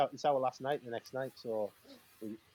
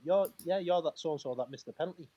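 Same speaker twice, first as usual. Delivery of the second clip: retold retort; 6 semitones higher, 4 dB louder than usual.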